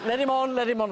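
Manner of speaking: a light take on Hugh letting him in; imitating Hugh Grant. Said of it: "like that".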